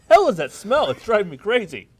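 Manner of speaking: hammed-up fury at a smell; exasperated shout. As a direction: blow up at the stink. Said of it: "And scene".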